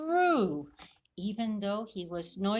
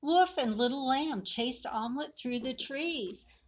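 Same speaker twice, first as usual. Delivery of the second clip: ordinary delivery, nothing marked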